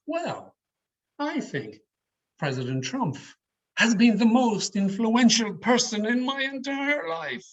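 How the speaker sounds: high, mock-earnest praise; sarcastic. Shown as a demonstration. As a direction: say it so they hear you mean the opposite. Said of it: "a measure of sarcasm there".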